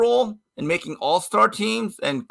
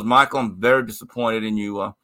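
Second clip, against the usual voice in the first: much lower, measured disappointed coach; stern coach; 10 semitones lower than usual. Retold or imitated. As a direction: deliver the suspension like a stern coach. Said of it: "and Jimmy goes".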